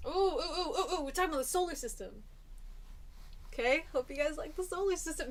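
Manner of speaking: high, quick, delighted squeals; excited. Real, not acted. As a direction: gush with excitement. Excited by next topic.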